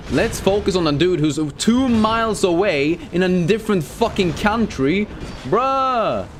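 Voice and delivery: high, sarcastic complaint; exasperated rant. Real, not acted. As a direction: vent at the dumb game.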